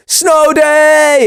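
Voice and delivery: high, drawn-out celebratory cry; gleeful whoop. Retold or imitated. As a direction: whoop it like school is cancelled. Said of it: "everyone just goes".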